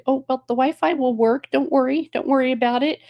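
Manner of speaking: high-pitched imitation of a stewardess reassuring. Stewardess to anxious passenger about Wi-Fi, high reassurance. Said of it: "And she's like".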